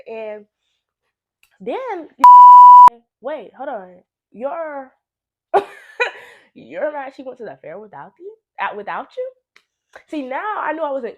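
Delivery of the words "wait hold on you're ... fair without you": ordinary talk, nothing marked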